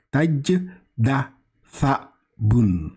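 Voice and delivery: Taj da sa boun read very slowly, each syllable apart; slow Arabic reading. Shown as a demonstration. Teacher models Taj da sa boun before saying allez-y.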